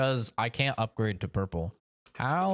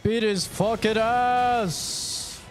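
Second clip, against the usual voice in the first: raging gamer; 5 semitones higher than usual. Real, not acted. Frustrated fight, yelling.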